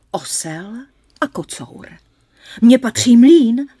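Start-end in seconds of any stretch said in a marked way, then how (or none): 2.6-3.7 loud, high proud claim; eldest brother's claim. Acted. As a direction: stake your claim on the mill loudly. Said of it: "řekl nejstarší".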